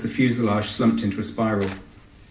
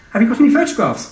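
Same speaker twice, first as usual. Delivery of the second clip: high piping policewoman, louder; 12 semitones higher, 6 dB louder than usual. Acted. Policewoman asking Tony for photos, high piping voice. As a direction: pipe it up high and eager. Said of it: "pit-piped the policewoman".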